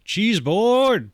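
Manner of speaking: high, loud excited cheer; squealing cheese joy; excited whoop. Acted. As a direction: whoop it out with glee. Excited player voicing character.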